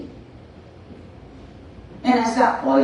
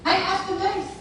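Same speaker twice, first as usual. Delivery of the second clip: much higher, eager announcing; 8 semitones higher, 4 dB quieter than usual. Acted. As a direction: show off the prize hopefully. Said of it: "And she goes".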